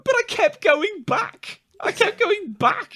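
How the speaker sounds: drawn out slowly and high, like a defeated whimper; slow high lament. Real, not acted. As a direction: drag it out, mock pitiful. Repeated, slowed, high and strained.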